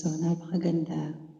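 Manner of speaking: usual speaking voice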